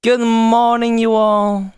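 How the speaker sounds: bright, high good morning call; cheery greeting. Shown as a demonstration. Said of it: "when I start my stream, I'll say good morning".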